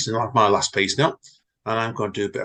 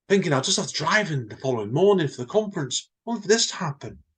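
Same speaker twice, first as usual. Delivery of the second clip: explaining manager; 6 semitones higher than usual. Acted. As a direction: lay out the excuse, a bit on edge. John explains why he left.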